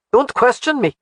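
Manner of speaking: snapped. Acted. Alec snapping at Jamie not to question him. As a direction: cut her off sharply. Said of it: "You needn't snap at me".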